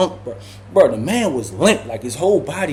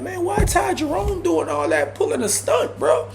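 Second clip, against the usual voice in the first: much higher, teasing; mocking tease; 6 semitones higher than usual. Teasing Ty for running from the fight, high mocking voice. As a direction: needle him with mock disbelief. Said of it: "So I'm like".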